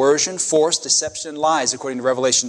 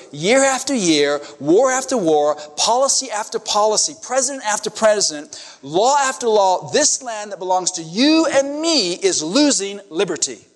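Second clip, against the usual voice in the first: building sermon litany; 5 semitones higher than usual. Real, not acted. Preacher warning the church about lost liberty, mounting urgency. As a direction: hammer the list home, climbing.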